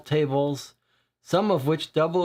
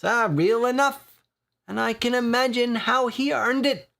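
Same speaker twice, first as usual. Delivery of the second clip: higher, teasing Irish dockside voice; grinning Irish regular ribbing the boys; jovial Irish accent with a grin; 8 semitones higher than usual. Acted. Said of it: "with an Irish accent".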